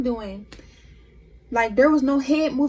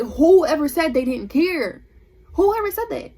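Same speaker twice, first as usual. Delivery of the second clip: loud, drawn-out indignant retort, rising higher; exasperated, mad; 3 semitones higher, 5 dB louder than usual. Retold or imitated. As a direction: push back on the accusation, acted out. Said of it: "it was just making me mad".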